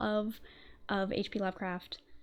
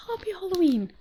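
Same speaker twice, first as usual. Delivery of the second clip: high, louder put-on Halloween voice; spooky high voice; 10 semitones higher, 6 dB louder than usual. Repeats greeting in put-on voice.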